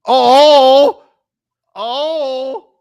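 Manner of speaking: loud high startled cry; shocked oh. Real, not acted. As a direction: react in shock. Shocked reaction watching clip.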